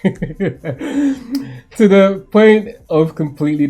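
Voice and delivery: slow, breaking into laughter mid-line; a laugh spilling over the words; laughing through words. Real, not acted. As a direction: read it while cracking up. Laughs while re-reading line.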